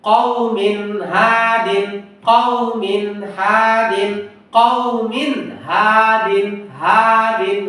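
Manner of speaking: kওমিন হাদিন drawn out slowly, clear ha; slow recitation. Shown as a demonstration. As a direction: chant কওমিন হাদিন slowly, stretching each vowel. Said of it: "হা এর তানমিন".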